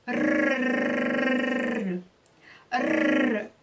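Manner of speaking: long tongue-tip trill, held; rolled R trill. Shown as a demonstration. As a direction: sustain a rolling rrr on a stream of air. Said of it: "E você vai tremer". (R vibrante prolongado, tremendo a língua)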